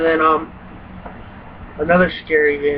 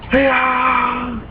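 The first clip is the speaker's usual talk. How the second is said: higher, yelping attack cry; enemy's war cry; 6 semitones higher than usual. Retold or imitated. Gamer imitating the enemy jumping out. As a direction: mimic a creature leaping at you.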